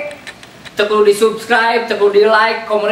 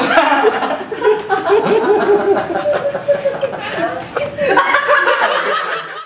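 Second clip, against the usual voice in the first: high squealing kitten mewl. Acted. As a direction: wail like a drowning kitten. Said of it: "Suara anak kucing dikecemplung di walungan".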